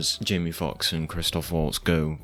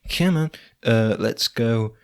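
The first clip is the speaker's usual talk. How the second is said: high, eager pleading voice; urging her to come along, high and loud; excited imitation of Ethan Hawke; 6 semitones higher, 4 dB louder than usual. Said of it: "and then Ethan Hawke's like".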